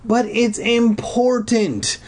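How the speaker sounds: slow, high-pitched pleading call. A narrator's reading. Girl calls after friends jogging away, insistent high call. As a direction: call after them, stretching it out. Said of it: "I called out as they jogged".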